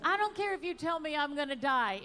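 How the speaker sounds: usual speaking voice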